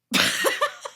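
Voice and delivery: high squealing laugh; burst of laughter. Real, not acted. Laughing at a friend's answer, high squeal. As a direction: crack up at the punchline.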